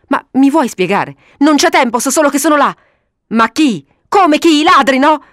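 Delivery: high, loud, impatient back-and-forth; exasperated, near-shouting; heated exchange. A narrator's reading. Hurried shouted quarrel in the car.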